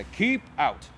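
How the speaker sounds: "Keep Out" punched loud as a warning; emphatic Keep Out. A narrator's reading. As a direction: read "Keep Out" like a stern sign. Sign read out emphatically.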